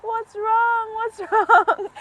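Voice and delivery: high, loud what's wrong, breaking into a laugh; alarmed concern. Retold or imitated. Asking crying girl what's wrong.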